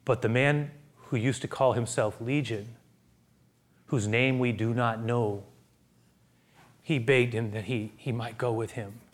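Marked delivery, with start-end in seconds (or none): none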